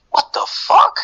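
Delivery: high, loud astonished cry; amazed shock. Retold or imitated. Seeing kangaroos on the beach, high astonished shriek. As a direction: squeal of disbelief. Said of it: "It's like".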